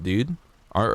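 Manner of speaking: usual speaking voice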